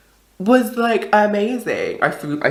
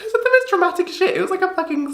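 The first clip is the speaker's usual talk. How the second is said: squeaky amused; faster than usual. Real, not acted. Laughing retelling, squeaky high.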